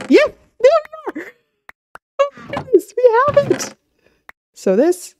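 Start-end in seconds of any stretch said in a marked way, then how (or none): 0.1-3.8 very high thrilled voice; excited squeal. Real, not acted. Gamer finally finding the ripped page, excited squeal. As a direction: squeal with relief and joy.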